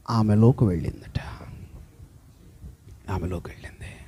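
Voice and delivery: whispered, hushed wonder; awed whisper as the power flows into her; reverent whisper. Acted. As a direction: drop to a whisper at the miracle. Hushed climax of healing miracle.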